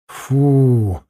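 a relieved "phew" on the breath; mild sigh of relief; exhaled relief. A narrator's reading. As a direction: breathe out after holding it in. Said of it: "выдохнул я".

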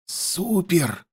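soft, hushed exclamation; quiet reply. A narrator's reading. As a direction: keep the excitement under your breath. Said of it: "так же тихо ответил я". (тихо, почти шёпотом)

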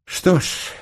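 resigned, breath-heavy sigh. A narrator's reading. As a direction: let it out on a sigh. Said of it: "вздохнул Очко". (со вздохом)

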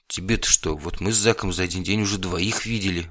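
plain reading voice

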